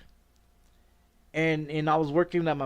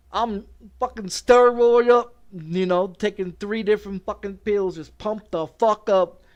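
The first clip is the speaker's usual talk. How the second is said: loud, higher, hyped-up brag; pumped up; 3 semitones higher, 7 dB louder than usual. Retold or imitated. Telling how juiced he felt at work, loud and hyped. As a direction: shout how pumped he was. Hyped describing steroid rush.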